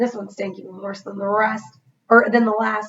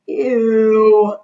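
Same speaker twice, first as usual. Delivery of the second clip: moaned; 7 dB louder than usual. A narrator's reading. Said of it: "I moaned".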